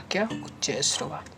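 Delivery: normal talking voice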